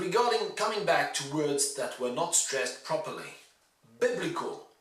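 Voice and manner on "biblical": 'Biblical' as BIB-lical, short i; short-i biblical. Shown as a demonstration. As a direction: stress BIB in 'Biblical', rhyming with rib. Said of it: "the adjective is biblical".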